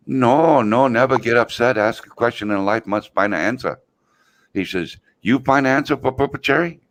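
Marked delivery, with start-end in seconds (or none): 0.1-3.8 put-on high, broken-English old Chinese man voice; old Chinese man imitation. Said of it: "the little old Chinese guy says". 5.2-6.8 put-on high, broken-English old Chinese man voice; old Chinese man imitation. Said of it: "the little old Chinese guy says".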